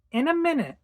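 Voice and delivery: put-on high-pitched child calling back; child's reply. Child answering mother's call to milk, high child voice.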